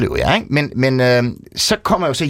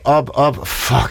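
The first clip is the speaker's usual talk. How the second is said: ordinary talk, nothing marked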